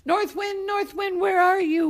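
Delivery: high, loud searching call; yelling. Acted. Boy hunting for the North Wind, yelling. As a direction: call out over a howling wind. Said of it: "he started yelling".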